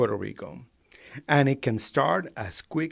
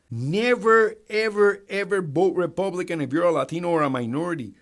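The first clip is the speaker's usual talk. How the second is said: higher, louder insistent never ever ever; loud warning; 6 semitones higher, 5 dB louder than usual. Real, not acted. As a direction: hammer the warning home.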